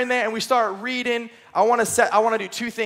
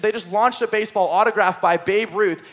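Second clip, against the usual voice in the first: usual speaking voice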